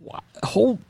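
high startled stammer; blurting in disbelief; stunned. Retold or imitated. He stammers at the sight of the huge hog, stunned.

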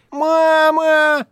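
high, loud wailing call for mama; boy calling for mama, high and loud; plaintive cry. Acted. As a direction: wail for mother to come save him. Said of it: "жалобно кричит Сашка".

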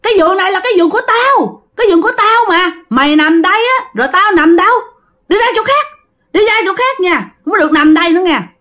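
high, fast, hostile ghost voice; giọng ma hung dữ. Acted. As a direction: shoo him off the bed like an angry ghost. Hồn ma đuổi chú khỏi giường. (giọng ma cao, nhanh, hung hăng)

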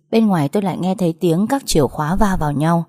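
ordinary delivery

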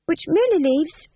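high-voiced, smooth polite refusal. A narrator's reading. Tom John politely declining the thieves' offer, high and smooth. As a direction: decline smoothly, pitched well up. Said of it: "said Tom John smoothly".

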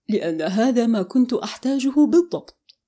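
ordinary delivery

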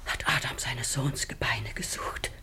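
a low, stricken whisper; horrified whisper. Acted. Mother discovers the opened grave.

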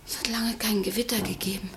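soft, hushed whisper; quiet ominous whisper. Acted. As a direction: barely breathe the words. Lucy whispers about a storm.

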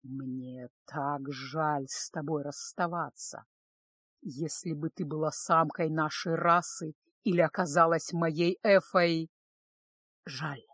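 low, soft, slow regret; deep-voiced master reluctantly parting with his favourite; regretful lord. A narrator's reading. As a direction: murmur it deep and lingering.

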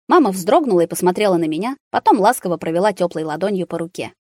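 usual speaking voice

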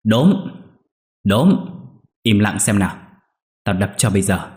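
man yelling at his barking dog; shouting at dog. Acted. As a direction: yell out to silence it. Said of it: "quát vọng ra".

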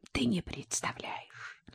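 slow secretive whisper. Acted. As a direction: breathe it as a secret. Boasting secret win.

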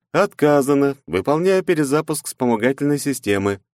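emotionless AI. Acted.

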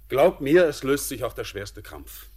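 sudden loud, high burst; emphatic loud appeal; forceful plea. Acted. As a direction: break in to convince him. Urging the troubled young man.

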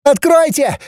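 very high first shriek; piercing shriek. A narrator's reading. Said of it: "завопила пронзительным голоском".

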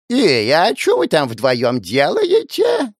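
put-on slow, nagging old woman's call; old granny. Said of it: "голос какой-то бабки".

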